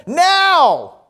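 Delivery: full-voiced shout, pitched very high; shouted command. Old monk repeats his order to the stunned newcomer, shouting. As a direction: bellow one word as an urgent order. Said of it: "And he shouted again".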